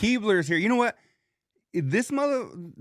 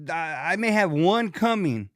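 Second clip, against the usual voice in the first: ordinary delivery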